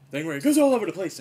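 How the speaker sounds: high, loud, fast manic riff; Robin Williams style. Retold or imitated. Likening himself to Robin Williams, manic riff. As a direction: bounce all over the place like a manic comic. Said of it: "like when Robin Williams does his little".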